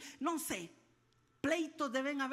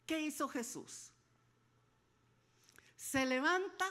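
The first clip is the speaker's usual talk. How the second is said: usual speaking voice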